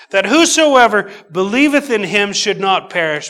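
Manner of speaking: loud, high, ringing scripture reading; preaching. Acted. Preacher proclaiming John three to the church, booming. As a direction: proclaim it to the back pews. Preaching the verse at full force.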